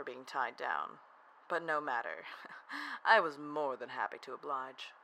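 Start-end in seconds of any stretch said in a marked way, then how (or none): 2.3-3.0 quiet, high little chuckle; light laugh under the breath; soft chuckle. Acted. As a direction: give a small, knowing laugh. Narrator chuckles within the story.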